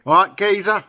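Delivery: broad Cockney, "geezer" sounding like "gayzer". Shown as a demonstration. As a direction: hail a mate in broad East End Cockney. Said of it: "they talk like that".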